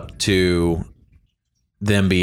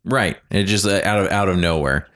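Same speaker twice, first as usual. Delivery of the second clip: normal talking voice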